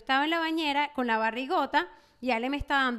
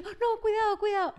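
high, anxious warning; fretful retold plea, pitched up; madre sobreprotectora; 8 semitones higher than usual. Retells her overprotective warnings. (advertencia nerviosa y aguda)

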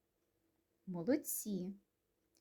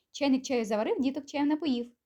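quick, higher-pitched run through the rhyme; faster tongue twister; 4 semitones higher, 4 dB louder, faster than usual. Shown as a demonstration. Teacher speeding children up on a tongue twister, faster. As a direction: rattle off the tongue twister briskly. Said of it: "тільки швидше".

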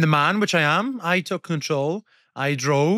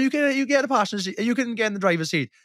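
higher, mock-heated shouting; retold shouting; 8 semitones higher than usual. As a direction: replay the roadside row with raised voice. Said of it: "We're shouting in the streets".

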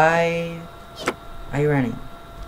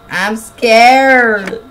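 louder, higher scared whine; 5 semitones higher, 6 dB louder than usual. Real, not acted.

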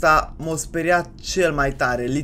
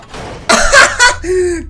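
high laugh; 11 semitones higher, 7 dB louder than usual. Real, not acted. Player gloats over the beaten enemy, high laughing. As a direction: burst of high, loud laughter.

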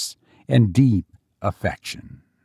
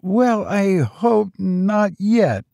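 high, slow, tentative servant; hesitant, high-pitched old retainer; old servant venturing; 9 semitones higher, slower than usual. A narrator's reading. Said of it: "ventured the old servant".

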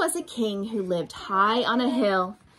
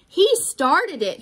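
louder, higher, slow accusing cry; crying out accusation; 6 semitones higher, 6 dB louder, slower than usual. A narrator's reading. King pointing at the dragon, loud accusing cry. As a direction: blame someone else loudly. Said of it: "he cried".

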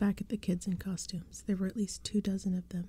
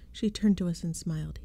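usual speaking voice

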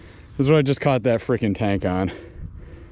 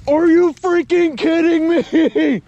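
much higher, louder and drawn out; ecstatic disbelief; 5 dB louder, slower than usual. Real, not acted. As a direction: stretch it out in stunned joy. Landing a huge fish.

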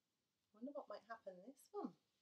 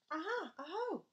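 high, loud triumphant cry; excited discovery; 9 semitones higher, 6 dB louder than usual. Acted. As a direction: pounce on the find. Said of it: "child discovers trail".

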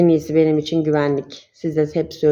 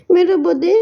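high, louder coaxing coo; 11 semitones higher, 6 dB louder than usual. Real, not acted. Asking her bird to say hello, high coaxing baby talk. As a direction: coax the bird to greet everyone.

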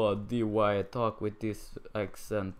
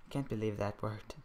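usual speaking voice

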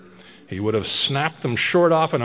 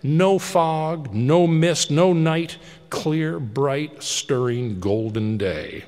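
ordinary delivery

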